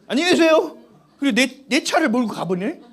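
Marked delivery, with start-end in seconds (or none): 0.1-0.8 1 cheeky goodbye. Retold or imitated. Neighbor's son cheerfully leaving.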